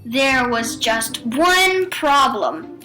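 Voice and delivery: plain reading voice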